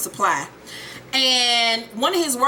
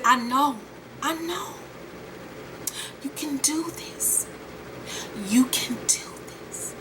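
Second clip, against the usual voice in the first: slow, intense whisper; mock-sinister soft urging; hushed coaxing; 2 semitones higher, 7 dB quieter, slower than usual. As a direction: whisper slow encouragement to a partner, acted. Acted hushed coaxing of partner.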